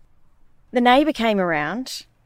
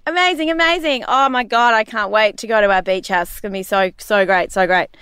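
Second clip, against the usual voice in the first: overdone thrilled mum; mock excitement; 4 dB louder than usual. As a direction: fake enthusiasm for the kids. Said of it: "And I'm saying".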